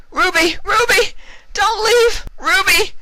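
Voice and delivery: piercing wailing shrieks; shrieking. Acted. Vinyl over dying Ruby, very high wailing shrieks. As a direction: shriek her name as she slips away. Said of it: "Vinyl shrieked".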